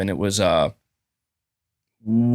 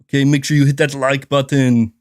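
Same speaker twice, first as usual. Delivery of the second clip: put-on lingering Arnold accent. Arnold voice continuing.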